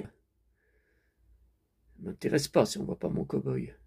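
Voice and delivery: low, soft, slow grumble; quiet sulky aside. Real, not acted. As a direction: pout and mutter it half to yourself. Sulky aside about her cowboy.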